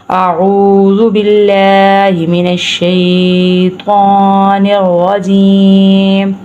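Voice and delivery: slow, drawn-out chanted recitation; slow Quran recitation. Shown as a demonstration. Teacher begins the lesson with slow recitation. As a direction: intone it slowly like a tilawat.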